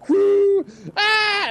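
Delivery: high whoop into a mock shriek; imitated scream. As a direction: mimic mom flinging a pan backwards in fright. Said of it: "she screams and throws this fucking pan".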